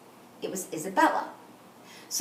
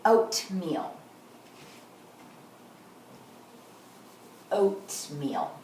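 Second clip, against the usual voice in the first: slow syllable demo; 6 semitones lower, 7 dB quieter, much slower than usual. Shown as a demonstration. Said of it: "I'm gonna say the first part".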